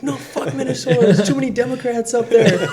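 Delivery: high, yelling through laughter; mock furious outburst; angry shout. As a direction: rant like a fed-up caller. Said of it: "he basically was screaming at me".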